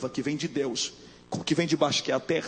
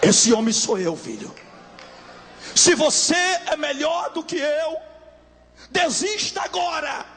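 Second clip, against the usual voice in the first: shouted much louder and higher, slowing at the end; preached shout; 11 semitones higher, 5 dB louder, slower than usual. Acted. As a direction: thunder it out as Jesus's challenge. Jesus's words shouted at climax. (grito de pregação, alto e agudo)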